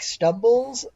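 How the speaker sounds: ordinary talk, nothing marked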